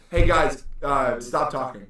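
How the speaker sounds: firm, slow stop talking; firm command. Retold or imitated. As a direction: tell them off calmly. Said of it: "I literally was like".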